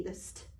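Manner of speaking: "st" said as one soft blended sound; quiet model of "st". Shown as a demonstration. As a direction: show the kids "st" run together. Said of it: "You have the S T".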